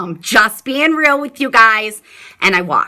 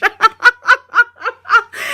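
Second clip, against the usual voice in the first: loud high-pitched laugh; 14 semitones higher, 4 dB louder than usual. Real, not acted. Mom cracking up at her daughter's innocent question. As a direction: let a big laugh out.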